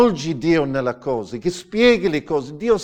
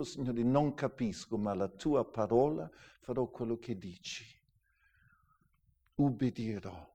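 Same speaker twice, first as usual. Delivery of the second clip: hushed, much softer prayer voice; humble submission; 3 semitones lower, 12 dB quieter than usual. Acted. Preacher voicing a believer answering the Lord, humbly obedient. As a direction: yield quietly, in a soft low murmur.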